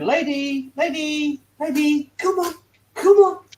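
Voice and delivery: high singsong dog-calling voice. Retold or imitated. Neighbours shouting their dog in from the back garden, calling out. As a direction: call a dog indoors, high-pitched. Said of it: "I heard 'em shouting the dog in".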